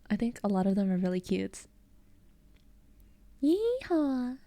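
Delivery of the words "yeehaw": high cowboy yeehaw. Acted. Streamer who likes accents teasing with a high American cowboy whoop. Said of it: "idea of an American accent is very".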